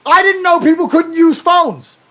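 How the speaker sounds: exasperated rant. Real, not acted. Exasperated rant about fans' phones.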